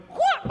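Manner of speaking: short high-pitched mock whoa; imitated yelp. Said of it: "like that".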